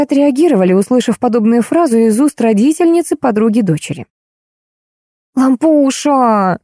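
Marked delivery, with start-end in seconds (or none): 5.3-6.6 high, very drawn-out coaxing call; pleading stage whisper. A narrator's reading. Girl wheedles into the phone, mock-whispering. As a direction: coax her with a long, slow, high vowel. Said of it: "зашептала в трубку Лизавета".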